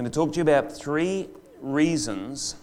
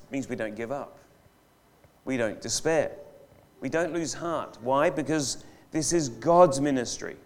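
ordinary talk

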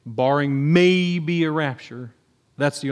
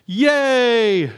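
sarcastic cheer; 6 semitones higher, 4 dB louder than usual. Acted. Ironic cheer at bad news.

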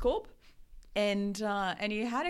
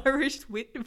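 breaking into laughter mid-word; laughing; 5 semitones higher, 7 dB louder, slower than usual. Real, not acted. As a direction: laugh through the stumble. Tongue twister fails, laughing.

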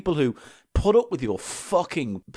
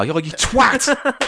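mock insult; 8 semitones higher, 4 dB louder, much slower than usual.

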